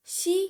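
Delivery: शी said with a full sh sound, not si; teacher sounding out शी, loud and high; clear shi. Shown as a demonstration. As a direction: stress the sh in शी. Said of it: "ये सी नहीं है".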